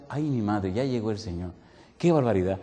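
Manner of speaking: soft, low, awed murmur; hushed dismay. Retold or imitated. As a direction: gasp it quietly as the end arrives. Own dismay in the dream.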